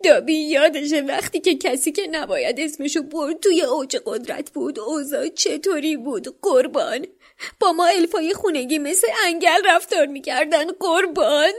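high, pleading elf voice; a small servant elf remembering dark days; house-elf voice. A narrator's reading. Dobby's speech continues.

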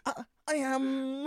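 very high, halting, drawn-out oddball voice; awkward, high-pitched sitcom-neighbour impression; Jim doing Thanos. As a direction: stretch each word high with long gaps. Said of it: "Jim from Friday Night Dinner".

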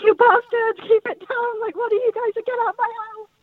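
old grandfather yelling up at the ghostly racket, high mock rant; irate elderly grandfather. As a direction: squeak out an old man's outraged swearing at noisy kids.

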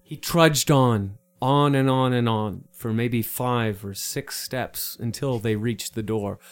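slow, drawn-out storybook narration; mock-epic narration of a short walk; story narration. A narrator's reading. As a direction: narrate the long slog slowly. Narrates trudging.

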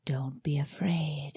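slow, unvoiced whisper; creepy whisper. Wife imitates the voice from the woods, slow whisper. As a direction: breathe it slowly like a lurking stranger. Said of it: "I hear again".

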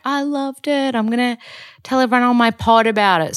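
high, bubbly gushing; excited gush. Retold or imitated. Imitating herself telling sponsors she loved the sauna, in a high gushing voice. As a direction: rave about it like a delighted fan.

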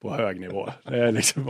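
ordinary talk, nothing marked

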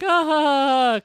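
high, loud imitated "ha ha"; mock audience laugh. Storyteller imitating the theater audience, mock laughter. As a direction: fake a delighted crowd's laughter. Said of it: "clapping and applauding and laughing".

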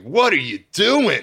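high, loud, drawn-out outcry; mock horrified yell. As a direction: wail it at the coworker, stretching each word. Scolding coworker over wasted boogers.

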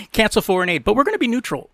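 high-pitched mock reading. Mocking her foreign policy to co-host, incredulous. Mocks the party's contradictory policy.

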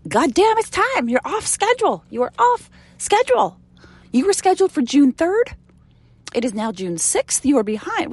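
high, loud pretend scolding; mock yelling at a flower. Yelling at a flower that hasn't bloomed, in a high mock yell. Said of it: "We don't go and yell at flowers".

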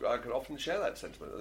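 ordinary delivery

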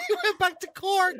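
high squealed words through laughter; helpless laughter. Real, not acted. Host cracking up at co-host's failed accent, laughing hard. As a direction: barely get it out for laughing.

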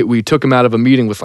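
ordinary talk

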